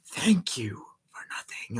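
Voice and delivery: slow whispered mock thanks; drawn-out, hushed fake gratitude; sarcastic whisper. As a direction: imitate a villain's whispered jibe. Imitating Robotnik's sarcastic thanks.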